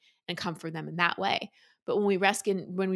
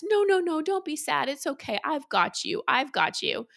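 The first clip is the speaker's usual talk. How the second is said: much higher, hurried soothing parent; rescuing parent; 8 semitones higher than usual. Retold or imitated. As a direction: rush in to hush a sad child. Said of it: "mom or dad comes in and rescues us".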